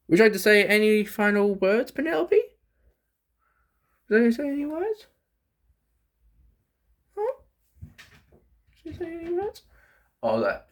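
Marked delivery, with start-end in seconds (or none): 0.1-7.3 much higher playful voice to the snake; high pet talk. Real, not acted. Asks snake for final words. 8.8-9.6 much higher and softer to the pet; soft high pet talk. Real, not acted. Asks pet again, softly.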